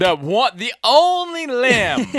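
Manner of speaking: exasperated. Real, not acted. Exasperated about the limb.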